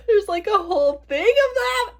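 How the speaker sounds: high, amazed squeal; excited. Real, not acted. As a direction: squeal at the discovery. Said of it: "so exciting".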